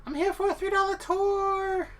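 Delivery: man doing a high falsetto woman's voice; lady voice. Pretend. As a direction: squeak it out as a high-pitched lady. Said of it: "That's my lady voice".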